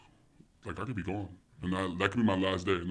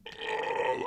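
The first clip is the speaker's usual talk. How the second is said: mock groggy groan; weary waking groan; 6 semitones higher than usual. Parent woken early by the baby, weary groan. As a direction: groan like being dragged awake.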